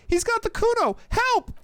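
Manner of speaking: put-on high, loud kid yelling for help; very high, shouted alarm; child's shout. A narrator's reading. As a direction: shriek it up at the windows in a boy's voice. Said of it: "the child shouts at the windows".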